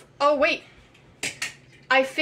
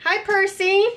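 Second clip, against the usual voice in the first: high, sing-song greeting; high pet talk; 6 semitones higher, 3 dB louder than usual. Pretend. Saying hi to the toy cat Percy, high pet talk. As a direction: greet a kitty in a raised voice.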